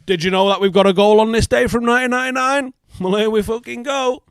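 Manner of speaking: hammed-up chirpy club account reading its post; mock cheery club post. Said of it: "and we're like".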